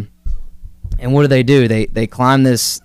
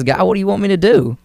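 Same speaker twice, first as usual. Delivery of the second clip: higher-pitched, quick appeal to God; retold plea; 9 semitones higher, faster than usual. Medic in battle asks God for orders, retold pleading. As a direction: imitate a man pleading for direction.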